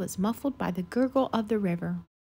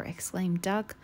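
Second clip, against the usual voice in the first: ordinary delivery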